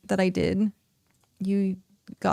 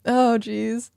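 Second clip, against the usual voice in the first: usual speaking voice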